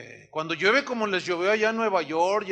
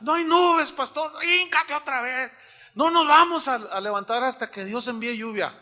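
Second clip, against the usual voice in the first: urgent retold voices; 5 semitones higher, 4 dB louder than usual. Retold rain prayer dialogue.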